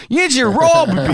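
a customer's hammed-up outrage at the price; mock outrage. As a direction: act robbed, mock outraged.

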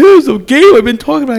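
high, loud, near-tearful plea; upset boy showing dad the game; teary kid. Retold or imitated. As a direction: plead like a kid about to cry. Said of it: "I got, like, watery eyes".

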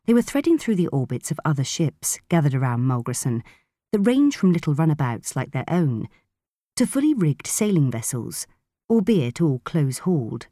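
ordinary delivery

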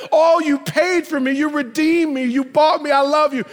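high, fervent tearful cry; imitated weeping gratitude. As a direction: pour out love as the sobbing woman. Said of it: "she's crying and she's weeping".

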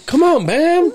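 high, loud mock dad whine; mock dad plea. Laughing about his dad, a whiny dad plea.

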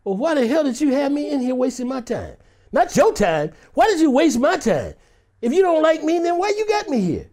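mock confrontation, rising in pitch; mad. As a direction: act out an annoyed complaint. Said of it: "I get mad at that".

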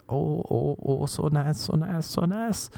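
a hammed-up high moan of pleasure; mock woman's moan.

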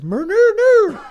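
very high, loud squealing no; high-pitched loud creature cry; Grimace creature shriek. Retold or imitated. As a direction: shriek it like a cartoon monster. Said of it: "Grimace goes".

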